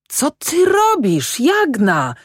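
high, slow, furious shout at his wife; angry yell. A narrator's reading. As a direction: yell across the river at her. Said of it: "wrzasnął Ślimak".

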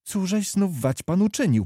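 high, worried asking; anxious question. A narrator's reading. Oleńka anxiously asks Kmicic what he has done. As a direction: dread what he did now. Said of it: "pytała niespokojnie Oleńka".

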